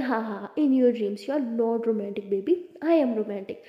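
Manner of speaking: ordinary delivery, nothing marked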